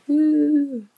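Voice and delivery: high, loud drawn-out cheer; high-pitched "woo" of celebration; celebratory woo. Real, not acted. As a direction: whoop with relief at good news. Celebrating a negative test result.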